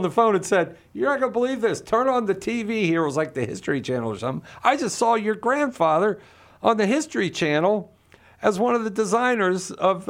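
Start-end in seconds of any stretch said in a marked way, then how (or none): 0.9-3.0 pitched way up, eager and breathless; a thrilled relative phoning you; high excited voice of an amazed father-in-law. Retold or imitated. Father-in-law calling with amazing news. 4.6-10.1 pitched way up, eager and breathless; a thrilled relative phoning you; high excited voice of an amazed father-in-law. Retold or imitated. Father-in-law calling with amazing news.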